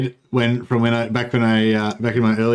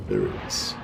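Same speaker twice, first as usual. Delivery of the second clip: much lower and softer, slow; mock deep voice; 9 dB quieter than usual. Gamer finding the hidden symbol, mock deep voice. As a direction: drop to a low mock-solemn rumble. Repeats it in a put-on voice.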